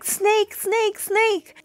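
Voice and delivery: high, frantic mock cry of snake; panicked little girl. Little girl running from the basement, mock panic. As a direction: squeal it like a scared kid. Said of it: "she's like".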